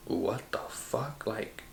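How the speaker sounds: slow, hushed disbelief; taken aback. Retold or imitated. Reacting to the friend's rude call, quietly taken aback. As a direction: draw it out softly, mock-incredulous. Stunned reply to aggressive call.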